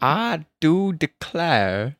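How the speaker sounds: put-on very slow, stretched drawl accent; careful amateur drawl accent; attempted drawl accent. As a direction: labour over each word in a drawling accent. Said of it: "where to put my draw".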